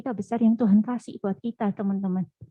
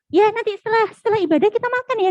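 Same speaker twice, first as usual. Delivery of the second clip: high, quick, louder promise; breezy promising; 10 semitones higher, 4 dB louder, faster than usual. Acted. Friend promising a meal after the service, high and breezy. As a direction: toss off an eager invitation.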